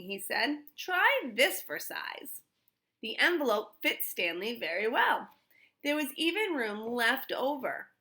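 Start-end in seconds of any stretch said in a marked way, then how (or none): 0.8-2.4 slow, higher playful father; drawn out with a flourish; fatherly offer. A narrator's reading. Father showing Stanley the envelope, slow and playful.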